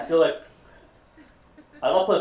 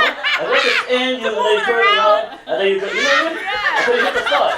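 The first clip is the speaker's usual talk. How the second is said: excited laughing rant; 9 semitones higher, 5 dB louder, faster than usual. Real, not acted. Building excited bit, laughing.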